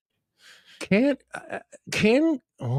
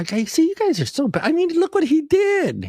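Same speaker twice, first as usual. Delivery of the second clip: rising mock outrage; 14 semitones higher, 4 dB louder than usual. Real, not acted. Defending the husband, mock outrage.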